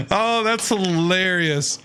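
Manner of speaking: normal talking voice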